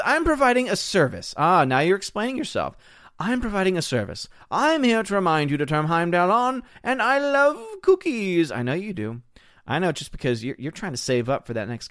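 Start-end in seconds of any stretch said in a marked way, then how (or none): 0.0-1.3 mock-earnest, high-pitched viewer voice; Will Gentry. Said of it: "Will Gentry then says". 3.2-8.5 hammed-up high voice, stretching the final words; Will Gentry. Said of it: "Will Gentry then says".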